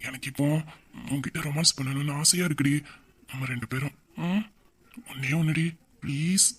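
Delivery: low, hushed romantic coaxing; quiet, intimate begging; soft pleading. Acted. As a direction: plead softly, in little pieces.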